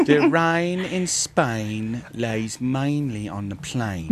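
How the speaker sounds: slow, soft mock British elocution. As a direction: pronounce each word like a phonetics lesson. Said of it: "I'm Eliza Doolittle".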